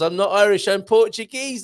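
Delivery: high, loud retold protest; mock giggly exclamation. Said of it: "she giggles".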